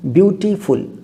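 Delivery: ब्यूटीफुल split into ब्यूटी and फुल; ब्यूटीफुल in two clear beats; syllable demo. Shown as a demonstration. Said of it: "पहले ब्यूटी आता है फिर फुल आता है".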